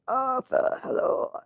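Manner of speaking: high start falling away in a put-on voice; badly faked actor greeting; Tom Hardy impression. Voice not up to it, attempted Tom Hardy voice. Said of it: "I can usually do a really good one".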